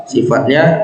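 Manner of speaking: ordinary talk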